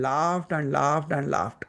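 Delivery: slow, stretched-out repeats; drawn-out repetition. A narrator's reading. Storyteller describing the sage's long laughing, slow drawn-out repetition. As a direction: draw out each "laughed" as it rolls on.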